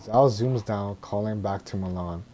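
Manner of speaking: usual speaking voice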